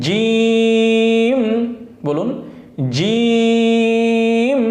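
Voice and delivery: জিম said firm and hard, held long; firm drawn জিম; hard jim. Shown as a demonstration. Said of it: "জিমটা শক্ত হবে".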